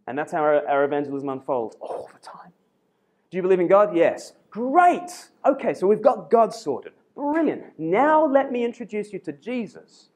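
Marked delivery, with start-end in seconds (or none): 3.3-10.1 high, bouncy, overjoyed sales patter; mock-eager evangelist. Evangelist thrilled to get God sorted, mock enthusiasm. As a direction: leap on the answer with hammed-up delight.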